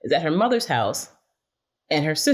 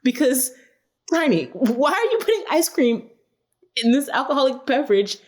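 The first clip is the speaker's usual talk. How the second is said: mock exasperation; 8 semitones higher than usual. Mock exasperated rant at cast.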